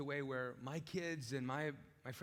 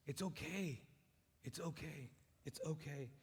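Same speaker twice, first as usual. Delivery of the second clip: reassuring; 3 semitones lower, 9 dB quieter than usual. Real, not acted. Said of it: "repeated reassurance".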